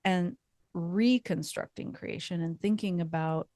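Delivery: usual speaking voice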